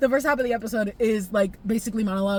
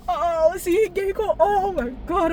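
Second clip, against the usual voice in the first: higher, catching crying voice; about to cry; 6 semitones higher, slower than usual. Real, not acted. Vlogger remembering Keiko, on the verge of tears. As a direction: let the tears nearly come. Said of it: "I'm gonna cry again".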